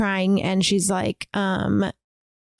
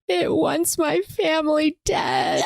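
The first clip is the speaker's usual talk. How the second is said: high, slow, imitated frightened cry; terrified girl; 8 semitones higher, slower than usual. Quoting Joey King telling her parents, high terrified wail. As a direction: let the voice climb in horror. Acting the girl's scream-crying line.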